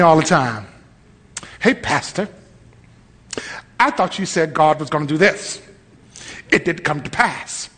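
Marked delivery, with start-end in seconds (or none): 1.6-7.7 low, soft needling churchgoer; imitating a facetious complainer; mock sarcastic. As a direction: put on a quietly sarcastic challenge. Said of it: "You're being sarcastic. People do this to me".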